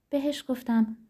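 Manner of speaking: ordinary delivery, nothing marked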